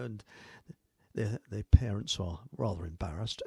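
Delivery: normal talking voice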